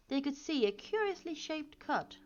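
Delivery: usual speaking voice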